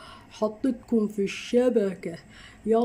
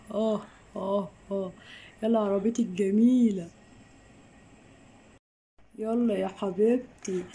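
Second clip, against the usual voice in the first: ordinary talk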